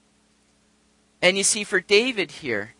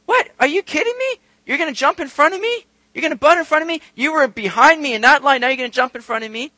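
high-pitched outraged retelling of a woman in line; mock indignant protest; mad, indignant; 8 semitones higher, 4 dB louder than usual. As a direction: act out affronted disbelief, pitch jumping up. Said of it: "she starts getting mad at this guy".

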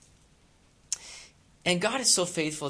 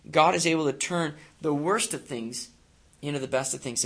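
ordinary talk

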